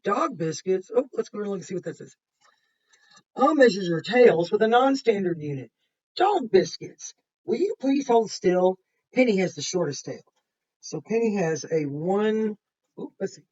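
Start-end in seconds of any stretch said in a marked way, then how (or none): 3.4-10.2 bright, louder storybook voice for Lisa; lively picture-book narration; reading to children. A narrator's reading. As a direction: read it to the class with energy. Reads Lisa's story aloud.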